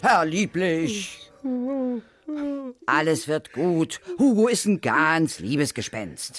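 langsam und sanft beruhigend. Acted. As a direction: wie zu einem verängstigten Kind sprechen. Beruhigt den aufgelösten Herrn Lieblich. (beruhigt den aufgelösten Herrn Lieblich, langsam und sanft)

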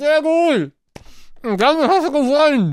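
talking round a mouthful of marshmallows; mouth full. Real, not acted. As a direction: cheeks stuffed, words mushy. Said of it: "ich lese dir mit vollem Mund".

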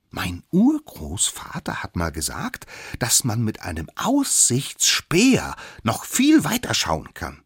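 lightly voiced, important smallest one; little know-it-all. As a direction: share family wisdom with importance. Youngest figure quotes great-grandfather's wisdom. (leicht gespielter kleiner Besserwisser)

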